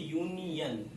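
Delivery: यूनियन said carefully, syllable by syllable; pronunciation demo. Shown as a demonstration. Teacher showing the Hindi sound of the word, pronunciation demo. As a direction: sound out यूनियन as "yoo-ni-yan". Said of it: "इसका उच्चारण हिंदी देखिए".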